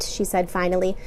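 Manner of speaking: plain reading voice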